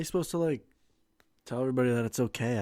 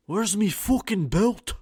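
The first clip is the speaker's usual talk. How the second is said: higher, slow mock Scottish brogue; hammed-up Scottish accent, drawn out; Scottish-sounding Irish; 7 semitones higher, 4 dB louder, slower than usual. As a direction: attempt Irish and land in Scottish. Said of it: "Still Scottish".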